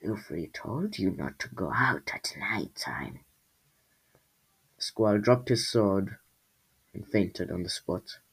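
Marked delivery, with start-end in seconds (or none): 0.0-3.2 soft whispered creature voice. A narrator's reading. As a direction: whisper it like a warning from the dark. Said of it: "He looked up to see Lufri".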